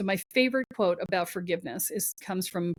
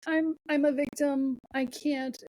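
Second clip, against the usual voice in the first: put-on higher, whiny little-girl helplessness; mock the helpless victim, voice up high; 7 semitones higher, slower than usual. Mimicking a helpless victim to the host, higher whimper. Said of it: "victim mode is".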